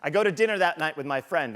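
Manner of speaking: usual speaking voice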